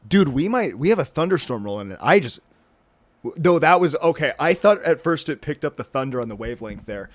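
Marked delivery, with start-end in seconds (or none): none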